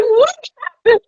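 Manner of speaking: high, quick, incredulous mock retort; exasperated retort. Mother to sulking daughter at the store, incredulous high retort. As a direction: fire it back fast and high.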